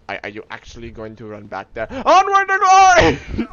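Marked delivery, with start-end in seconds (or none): 1.9-3.5 high, loud, drawn-out charge cry; battle cry. Pretend. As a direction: bellow it high, stretching the words.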